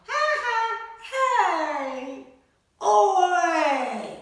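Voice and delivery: high, loud mock jeer and protest; hammed-up playground yells; playground shouts. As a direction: call out as the cheeky bully then the annoyed pig. Bully pushes past on slide.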